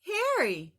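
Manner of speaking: high, loud birdlike call; Big Bird voice. Acted. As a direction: sing out his name like a gentle giant bird. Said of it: "said Big Bird".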